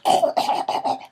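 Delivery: hoarse then squeaky high "I'm fine"; croaky fake-fine. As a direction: pretend to be fine between coughs. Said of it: "I'm going".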